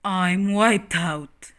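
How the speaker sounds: very slow tired sigh-voice; worn out. Acted. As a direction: drag it out exhausted. Ham tired after game.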